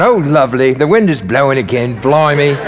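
genteel, unbothered mock British; mock British accent. Disciples idly chatting in the boat, mock British accent. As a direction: put on a hammy British accent. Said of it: "like, British accents going".

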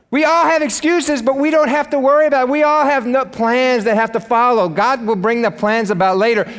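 high-pitched, driving sermon exhortation; impassioned preaching. Real, not acted. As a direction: preach it hard and urge them on.

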